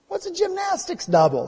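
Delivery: put-on high-pitched puzzled child; six-year-old son asking. Young son to his actor dad, puzzled child. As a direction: pipe up like a baffled little boy. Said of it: "he asked".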